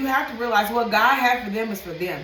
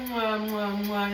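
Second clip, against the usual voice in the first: blown kisses; 5 dB quieter, slower than usual. Real, not acted. Blowing kisses to viewers at sign-off.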